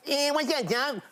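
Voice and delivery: high cartoon rabbit voice; Bugs Bunny. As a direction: trot out the rabbit impression for a fan. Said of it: "you were Bugs Bunny on Hard Quiz".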